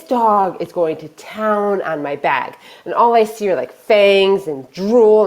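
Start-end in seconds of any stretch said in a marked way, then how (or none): none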